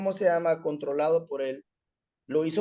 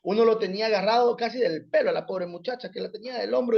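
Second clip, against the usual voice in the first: normal talking voice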